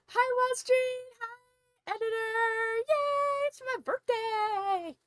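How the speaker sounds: squealing high greeting; bubbly birthday excitement; excited. Real, not acted. Greeting viewers excitedly on stream.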